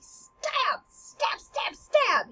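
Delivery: high, punched repetitions; playful rapid-fire sound effect; mimed stabbing. Acted. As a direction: jab each word like a sword thrust. Miming repeated sword thrusts.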